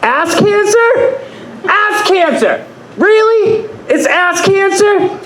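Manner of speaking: high, slow, disbelieving cries; incredulous yelling. Retold or imitated. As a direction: shriek it out in mock disbelief, drawn out. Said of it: "getting progressively louder and louder".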